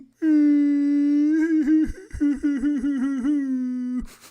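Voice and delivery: high, loud howling laugh; loud cackling laugh. Real, not acted. Laughing at his own deuces joke to viewers, loud howling laugh. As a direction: burst out laughing till it nearly turns to crying.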